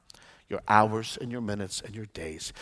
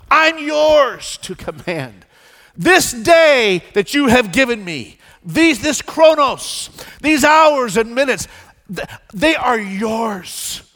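fervent declared prayer; 8 semitones higher, 11 dB louder than usual. Acted. Preacher leads the church in a loud declaring prayer. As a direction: pray it out boldly to the Lord.

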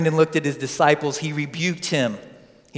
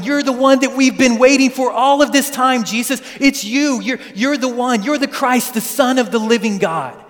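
higher and louder, earnest excitement; eager Peter; 5 semitones higher, 4 dB louder than usual. Retold or imitated. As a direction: gush it out like a fan meeting his hero. Peter voiced as eager believer.

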